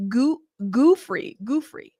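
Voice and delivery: Guffrey said slowly, GOO-free, testing the sound; trying out Guffrey. Shown as a demonstration. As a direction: sound out Guffrey carefully, unsure. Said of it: "help me pronounce things".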